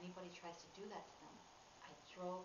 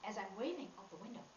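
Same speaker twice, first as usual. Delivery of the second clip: normal talking voice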